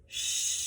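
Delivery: breathy shh with belly tension; unvoiced shush. Shown as a demonstration. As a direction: push the air out on a shh. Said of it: "Feel the tension and shush".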